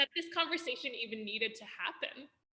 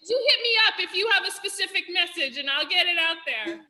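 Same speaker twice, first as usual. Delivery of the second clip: loud, higher, fast, fired-up call; getting loud; 9 semitones higher, 14 dB louder, faster than usual. Real, not acted. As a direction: pump up the crowd, loud and quick. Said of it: "And I'm here to get loud with you".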